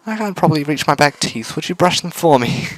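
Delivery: put-on high sweet girlish voice; cute robot girl voice.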